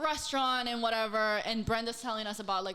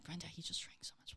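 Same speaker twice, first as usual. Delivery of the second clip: hushed whisper to the roommate; 5 semitones lower, 16 dB quieter, faster than usual. Retold or imitated. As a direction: breathe it out so he can't hear. Telling roommate secretly about drunk guest.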